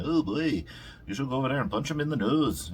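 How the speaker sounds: fast, high, loud mock Irish accent; badly faked Irish dad egging on his kid; Conor McGregor. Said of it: "can't do a fucking Irish accent".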